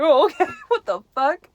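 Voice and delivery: bursting into laughs mid-line; high, loud amused disbelief; laughing. Real, not acted. Laughing at the surprise.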